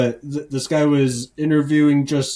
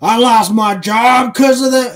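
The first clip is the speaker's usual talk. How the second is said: angry local's yell; 9 semitones higher, 7 dB louder than usual. Retold or imitated. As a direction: yell it like an outraged hillbilly. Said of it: "He's like".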